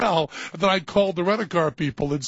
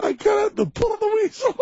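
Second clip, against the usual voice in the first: laughing. Real, not acted. Host laughing through the phrase.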